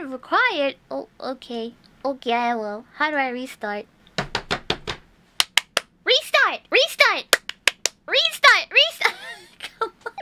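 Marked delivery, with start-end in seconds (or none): 6.0-9.1 high-pitched repeated command; insistent calling out. Real, not acted. Streamer demanding game restart, high insistent call. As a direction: cry it out high, over and over.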